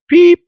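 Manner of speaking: a sharp, high whistle "beep". Retold or imitated. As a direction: mimic the ref's whistle. Imitating the referee's whistle.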